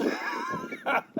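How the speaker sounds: a high hooting burst of laughter; high cackle. Real, not acted. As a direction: cackle at the punchline. Breaks up after punchline.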